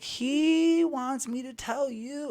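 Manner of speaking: very high, slow prophetess impression; entranced old lady. Gym lady relays God's message, high drawn-out trance voice. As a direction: draw each word out high, as if channelling. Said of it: "her eyes were closed the whole time".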